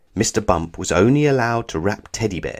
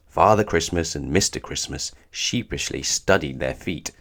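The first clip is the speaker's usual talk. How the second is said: ordinary delivery, nothing marked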